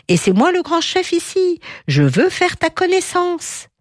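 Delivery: voix de vautour très haut perchée; proclame-toi chef, d'une voix aiguë; lettre fanfaronne. A narrator's reading. Vulture writes as boastful lion. (fanfaron, voix haut perchée)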